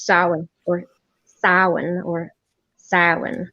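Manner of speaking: "Samhain" said sah-en, then sow-en, two syllables each; "Samhain" tried slowly with an A then an O sound; Gaelic variants. Shown as a demonstration. Showing friends the variants of "Samhain", slowly. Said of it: "So an A sound or O sound".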